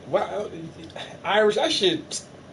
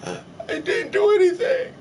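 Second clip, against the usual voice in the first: high, slow, whiny fake sob; mock crying. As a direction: whimper it like a scolded child.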